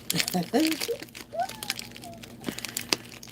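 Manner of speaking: very high-pitched squealing laugh. Real, not acted. Vlogger amused by an accent question, long high laughing.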